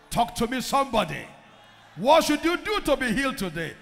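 ordinary delivery

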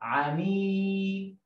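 عميد stretched very slow, every sound held; slow teaching read of عميد; slow drawn-out model word. Shown as a demonstration. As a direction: spell out عميد slowly for a child. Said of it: "زي كلمه".

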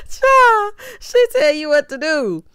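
high, loud words through a laugh; laughing exclamation. Real, not acted. Still cracking up at her own joke, laughing loud and high.

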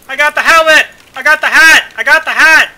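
loud, high triumphant yelling; excited shout. Real, not acted. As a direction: crow about the loot at the top of your lungs. Excited gaming shout over loot.

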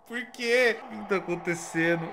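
ordinary talk, nothing marked

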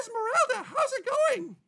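high-pitched diva greeting. Acted.

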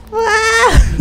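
piercing high shriek; sudden scream. Real, not acted. Player startled by a monster, sharp shriek. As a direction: shriek at the jump scare. Jump scare.

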